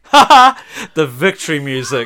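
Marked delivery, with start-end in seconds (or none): 0.0-0.6 loud burst of laughter. Real, not acted. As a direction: laugh at your own pun. Laughs at own pun.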